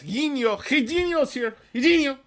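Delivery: high, loud, sing-song greeting; a stretched, cartoonish welcome call; excited shout-out. Real, not acted. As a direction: hail a friend across the room. Greeting a viewer by name.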